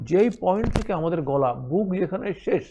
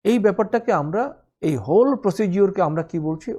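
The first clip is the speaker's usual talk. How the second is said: normal talking voice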